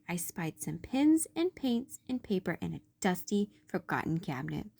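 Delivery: plain reading voice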